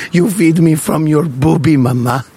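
put-on comic German-accented son. Ponce talking to his nursing mama, in a German accent. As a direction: play a mama's boy with a German accent. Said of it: "I don't know why he's German".

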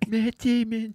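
high mock name-only impression; Matt Damon. Co-host imitates Matt quietly in the back, high put-on voice. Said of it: "Matt just quietly in the back".

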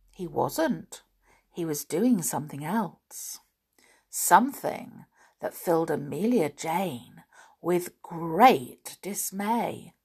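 usual speaking voice

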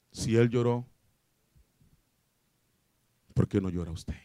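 low, soft delivery with long pauses; hushed, grave appeal to the congregation; solemn preaching. Acted. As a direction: drop down low and let each phrase sink in.

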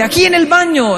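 put-on high, stretched kid's holler; acted child's high call; yelling from afar. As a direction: shout it out from the bathroom. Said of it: "está gritando".

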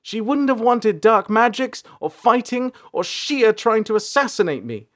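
high, loud angry list; high-pitched defiant yell; yelling back. A narrator's reading. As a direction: hurl accusations back at your father. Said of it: "April yelled back".

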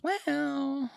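high, drawn-out "well"; hedging doctor. Retold or imitated. Doctor dodging the cancer question, hedging. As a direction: stretch it high to put off answering.

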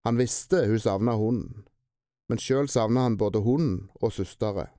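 plain reading voice

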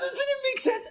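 giggling plea for agreement; laughing question. Real, not acted. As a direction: ask it while laughing hard.

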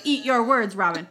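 loud teasing gloat, pitch up on words; mock gloating. Replaying the clip to tease Robin, gloating. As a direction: rub it in, playfully. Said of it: "I'm gonna replay this and say".

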